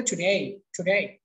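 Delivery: American টুডে, tu-dey; American pronunciation. Shown as a demonstration. Teacher contrasting with British today, American টুডে ending in "ey". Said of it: "আর আমেরিকানরা বলে".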